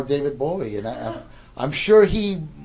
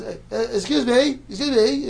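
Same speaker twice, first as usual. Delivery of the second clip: higher, polite attempt to get attention; tentative interruption; 10 semitones higher, 5 dB louder than usual. Waiting visitor calls to roommate in bedroom, tentative interruption. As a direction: imitate a guest politely interrupting. Bowie timidly interrupting from other room.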